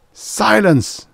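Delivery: high, loud, sharp command; dangerous. A narrator's reading. Priest silencing the raving impostor, dangerous. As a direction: cut him off with menace. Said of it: "The priest's voice was dangerous".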